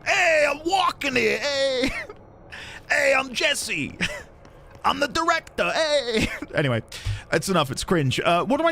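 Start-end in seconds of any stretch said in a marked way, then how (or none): 0.0-6.3 put-on higher, brash New York accent, breaking into laughs; hammed-up New Yorker shouting from the street, giggling through it. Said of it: "I'll do a different American accent".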